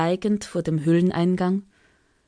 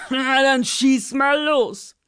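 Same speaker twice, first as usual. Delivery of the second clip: gelangweilter Drache; 6 semitones higher, slower than usual. Acted. Dragon answers.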